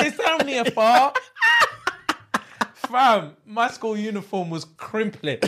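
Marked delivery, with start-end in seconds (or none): 0.0-1.2 high, rising reassurance; imitated teacher excusing it; pacifying teacher. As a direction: play it down in a raised pitch. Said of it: "My teacher's like".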